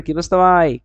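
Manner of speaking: loud, slurred-together "কি বস্তা ভাই"; contracted greeting. Shown as a demonstration. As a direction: hail a friend with "কি বস্তা", first vowel swallowed. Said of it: "উচ্চারণটা এরকম হয়ে যায়".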